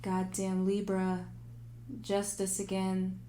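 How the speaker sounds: usual speaking voice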